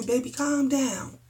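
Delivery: soft, slow soothing "calm down"; gently calm someone down. Retold or imitated. Husband soothing an angry wife.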